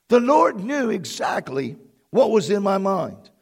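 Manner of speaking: ordinary talk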